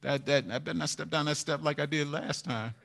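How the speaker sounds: soft, quick muttering to oneself after a fall; hushed fast inner voice; cautious self-talk. Retold or imitated. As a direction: warn yourself under your breath, hurried. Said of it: "you just say".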